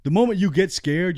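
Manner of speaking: fired-up accusation; heated rant. Real, not acted. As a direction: hit it hard, accusingly.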